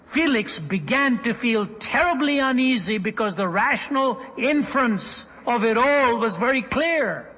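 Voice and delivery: high, loud sermon climax; impassioned preaching. Real, not acted. Preacher proclaiming the woman's new worth, impassioned preaching. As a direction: preach it out with ringing, emphatic force.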